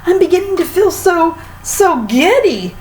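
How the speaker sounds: very high frantic cry for help; a hammed-up very high giddy wail; dizzy panic. A narrator's reading. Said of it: "screamed Missus Twit".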